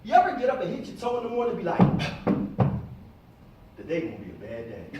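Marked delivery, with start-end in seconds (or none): 1.8-4.9 much lower, sighing doom voice; mock gloomy grumble. Speaker mimics someone who hit their toe, grumbling low. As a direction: sink the voice into a sulky gripe.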